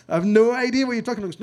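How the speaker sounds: rising exasperation at a friend who makes no sense; exasperated outburst. Retold or imitated. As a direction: blurt it out, baffled and exasperated. Baffled reply to John's call.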